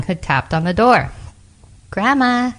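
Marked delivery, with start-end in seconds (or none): 1.9-2.5 high, light calling voice; little girl calling. A narrator's reading. Girl at Grandma's door, calling in high. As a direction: call in gently, pitched up. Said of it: "she called".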